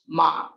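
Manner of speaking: MA held low in pitch; lengthened low MA; grave tone. Shown as a demonstration. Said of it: "ma with grave pitch".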